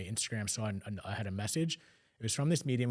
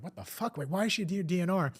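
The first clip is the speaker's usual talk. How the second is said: higher, stunned outburst of disbelief; shocked disbelief; 7 semitones higher than usual. Retold or imitated. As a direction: blurt it out, caught completely off guard. Shocked at mom's DNR status.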